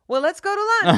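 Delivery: high, loud laid-back [name]; imitating [name]. Singer calling lunch after the question is answered, hammed-up. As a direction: call lunch as if it's all settled. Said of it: "And he goes".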